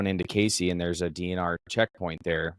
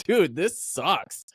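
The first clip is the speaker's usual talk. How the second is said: high, very slow complaint; exasperated whine; 9 semitones higher, much slower than usual. Acted. Hunter griping to the guys at the next pickup, exasperated whine. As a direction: stretch it out in hammed-up misery.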